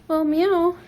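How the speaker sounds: high loud meow; cat meow. Pretend. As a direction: meow back at the kitty. Answering the cat.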